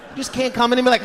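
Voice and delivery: ordinary delivery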